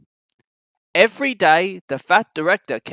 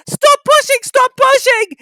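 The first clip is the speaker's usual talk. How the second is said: very high, loud frantic cry; desperate plea; 10 dB louder than usual. A narrator's reading. Thomas begging the trucks to stop, high and loud. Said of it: "he hissed".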